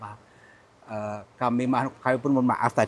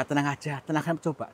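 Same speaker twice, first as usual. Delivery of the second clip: usual speaking voice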